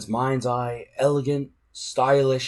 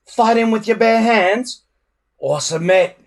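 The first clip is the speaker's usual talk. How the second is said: higher, louder jeering; sneering; 10 semitones higher, 8 dB louder than usual. A narrator's reading. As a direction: taunt the loser. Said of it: "sneered Snotlout".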